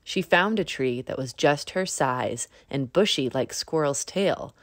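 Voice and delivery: usual speaking voice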